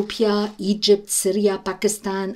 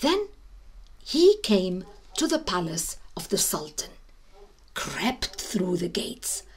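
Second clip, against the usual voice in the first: usual speaking voice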